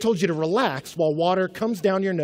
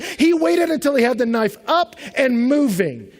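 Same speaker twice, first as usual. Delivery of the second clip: rising higher and louder each line; building intensity; 7 semitones higher, 5 dB louder than usual. Real, not acted. Preacher listing chances God had to stop him, building.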